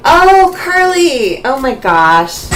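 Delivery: high, loud delighted gasp; surprised. Real, not acted. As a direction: burst out in happy surprise. Recognizing a viewer in chat.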